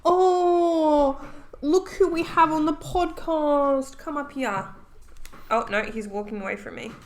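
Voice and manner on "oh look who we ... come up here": put-on baby-talk voice. Greeting the dog in baby voice.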